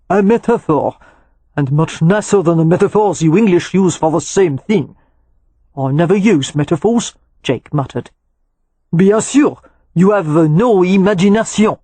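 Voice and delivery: a smug cook teasing the stiff Englishman; superior French chef. Acted. Broussard lectures Jake.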